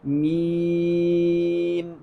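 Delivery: "میم" held very long on a level note, m only at the end; slow, chant-like sustained "میم"; correct meem. Shown as a demonstration. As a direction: stretch the pure ee of "میم" before closing. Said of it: "تو ہم نے کیا پڑھنا ہے".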